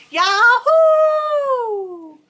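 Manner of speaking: joyful cowboy whoop; rebel yell. A narrator's reading. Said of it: "he let out a rebel yell".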